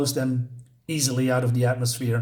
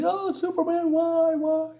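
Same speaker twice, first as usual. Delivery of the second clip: shrill high wailing "why, why"; mock pleading crowd; 14 semitones higher than usual. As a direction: whine it way up high like desperate townsfolk. Townspeople begging Superman, mocked.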